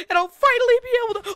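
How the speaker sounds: rushing excitedly. Acted. Monologue rushed before interruption.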